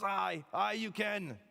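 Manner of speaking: a half-serious Braveheart Scot; mock Scottish brogue. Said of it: "He's part pirate. No, he's Scottish".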